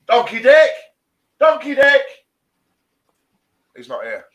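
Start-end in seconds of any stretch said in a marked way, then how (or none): 0.1-2.0 high, loud repeated call; loud, high mocking call. Real, not acted. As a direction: shout his nickname across the room. Calling out absent John.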